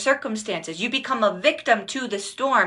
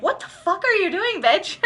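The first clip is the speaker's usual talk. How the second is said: mock outrage; 10 semitones higher than usual. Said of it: "you're like".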